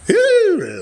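high loud ooh; excited ooh. Real, not acted. Seller squealing with glee at the end of the stream.

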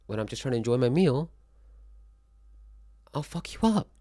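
loud and high, worked up; warning anyone who comes at his meal; heated rant. Real, not acted. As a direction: rant at them, getting fired up. Ranting at imagined vegan critic.

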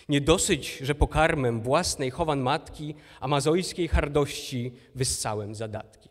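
slow, loud, measured verse declamation; poem recitation. Acted. Recites Hipolit's verse from Fedra. (powolna, donośna deklamacja wiersza)

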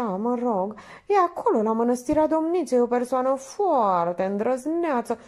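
high-pitched, admiring chatter; gushing Minerva. Acted. Minerva praising brave domnul Popescu, gushing.

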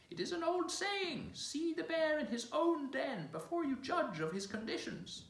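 very high, slow croaky bird voice; croaking raven. Acted. The raven quoting an old saying to the royals, croaking. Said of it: "croaked the raven".

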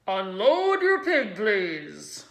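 loud, slow PA call; loudspeaker announcer. A narrator's reading. Announcer telling the farmers to unload, loud. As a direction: call it out slowly over the speakers. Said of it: "said the loudspeaker".

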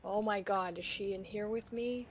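ordinary delivery

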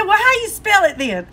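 high, challenging mock husband. Husband challenges wife on spelling, high mock voice. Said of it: "He said".

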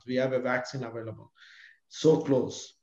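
normal talking voice

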